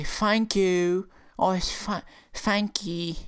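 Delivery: high-pitched mock accent with "fank you"; exaggerated, squeaky th-fronting accent. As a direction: mimic an accent that turns th into F. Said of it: "They say it as an F".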